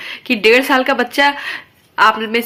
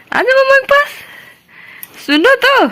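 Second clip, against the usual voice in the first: sing-song coaxing; 14 semitones higher, 4 dB louder than usual. Real, not acted. Mother coaxing her sulking toddler, high sing-song. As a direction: coax him over in a high sing-song.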